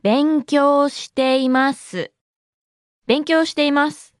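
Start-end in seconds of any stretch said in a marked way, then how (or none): 0.0-2.1 slow, syllable-by-syllable demonstration; slow model. Shown as a demonstration. Teacher repeats the response for learners, slow and measured. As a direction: draw out every syllable for learners.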